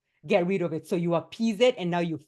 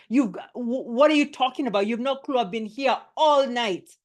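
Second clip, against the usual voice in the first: loud, higher, indignant snap back; attacking back; 6 semitones higher, 6 dB louder than usual. Shown as a demonstration. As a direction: fire back at the bully, stumbling in anger. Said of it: "you could attack me back".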